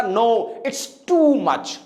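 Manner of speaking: 'too' stretched long and stressed; slow acted refusal, lingering on 'too'; stress on TOO. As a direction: lean hard on a drawn-out 'too'. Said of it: "आपने कहा".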